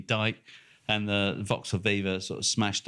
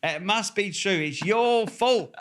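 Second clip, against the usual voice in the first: mocking indignant kid; 11 semitones higher, slower than usual. Mocks the young driver's letter.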